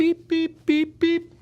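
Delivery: high mock beep-beep of a scanner; imitating the checkout scanner, high beeps. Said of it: "The checker's just like this".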